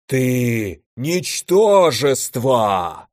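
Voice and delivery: very slow, drawn-out monster voice; raging elemental. A narrator's reading. Elder elemental lunging at Dennis, slow monstrous rage. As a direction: bellow it slowly like a fire giant.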